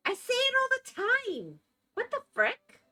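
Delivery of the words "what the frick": normal talking voice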